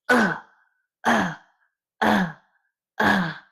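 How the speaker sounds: sharp game damage cries; pained hit grunts. Shown as a demonstration. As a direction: bark out each hit like taking a punch. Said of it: "Constantly be exhaling voices".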